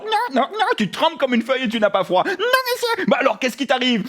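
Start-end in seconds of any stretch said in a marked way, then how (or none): 2.2-3.0 put-on very high, childlike creature voice; little dog character. Little dog answering the host, high creature voice. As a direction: squeak it politely, very high.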